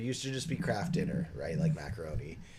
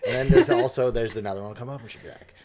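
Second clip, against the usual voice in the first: usual speaking voice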